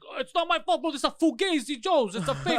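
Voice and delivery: high, loud defensive yell; loud sharp voice. Retold or imitated. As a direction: yell it back, offended and sharp. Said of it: "the way he was yelling was amazing".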